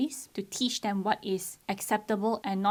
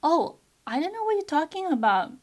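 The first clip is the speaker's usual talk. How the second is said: high, loud, feigned innocence; mock innocent child; 8 semitones higher, 6 dB louder than usual. Parent mimicking child's pretend ignorance inside a lecture, high and loud. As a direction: sing out the fake ignorance.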